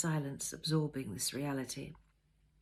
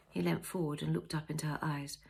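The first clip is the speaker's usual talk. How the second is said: usual speaking voice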